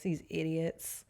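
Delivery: ordinary delivery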